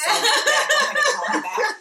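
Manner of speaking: high, loud, laughing call me back; laughing mock brush-off. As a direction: laugh it off while hanging up. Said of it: "And I was like".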